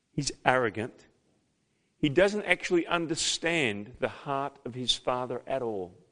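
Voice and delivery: ordinary delivery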